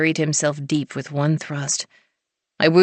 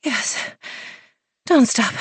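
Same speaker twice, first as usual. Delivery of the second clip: breathy heroine; 7 semitones higher than usual. A narrator's reading. Heroine pleading, voiced higher.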